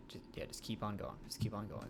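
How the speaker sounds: soft whisper to keep going; whispered encouragement. Pretend. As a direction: whisper it to urge him on. Quietly encouraging Mark.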